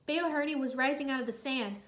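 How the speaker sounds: usual speaking voice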